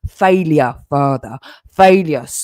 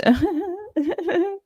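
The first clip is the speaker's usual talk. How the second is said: mocking laugh; 5 dB quieter than usual. Mocking the rival, high laugh.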